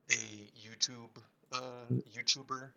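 faint bad phone line; words fading out on a bad connection; barely audible. Real, not acted. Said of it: "I can barely hear you".

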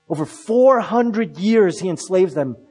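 usual speaking voice

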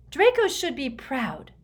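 usual speaking voice

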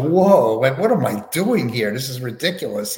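recreate being thrown, with a big loud whoa; retold alarm. Sitter recalling a scary first medium session, startled disbelief. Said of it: "It was kind of scary to me".

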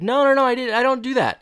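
high-pitched, loud imitated denial; mock protesting. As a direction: squeal a hammed-up protest. Retold flustered denial of accusation.

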